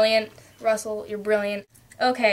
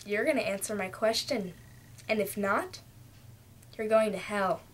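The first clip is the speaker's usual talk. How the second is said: menacing Dora; 3 semitones lower, 8 dB quieter than usual. Retold or imitated. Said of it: "No, it's like".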